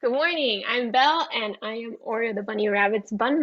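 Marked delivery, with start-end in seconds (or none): none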